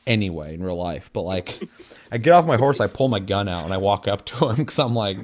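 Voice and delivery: usual speaking voice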